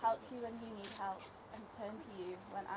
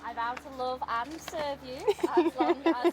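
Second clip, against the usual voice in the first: loud joyful vow; 4 semitones higher, 12 dB louder than usual. Real, not acted. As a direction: belt out the vow gladly. Vows said loud, laughing.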